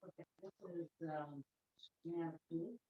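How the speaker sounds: distant, off-mic voice; speaking from across the room; from another room. Real, not acted. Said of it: "You're a bit far from your microphone".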